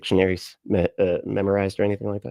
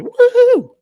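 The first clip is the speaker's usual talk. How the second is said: high, loud woohoo; imitated whoop; 10 dB louder than usual. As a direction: whoop like a hyped fan. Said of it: "Jazula said".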